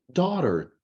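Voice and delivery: slow American "daughter" with voiced R; American pronunciation. Shown as a demonstration. Teacher contrasts it with British for students, American accent. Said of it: "pronúncia americana".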